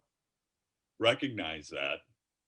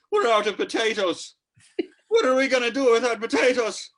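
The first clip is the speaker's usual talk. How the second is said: high, loud panicked mock-Irish wail; Irish accent; 12 dB louder than usual. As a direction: wail it like a hammed-up Irishman in crisis. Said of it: "you threw in an accent".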